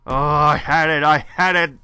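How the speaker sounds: loud whining that it slipped away; real frustration, loud and rising; loud frustrated wail. Real, not acted. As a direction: cry out that you had it. Lamenting the lost reward.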